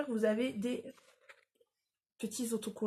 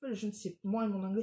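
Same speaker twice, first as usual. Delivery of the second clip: ordinary delivery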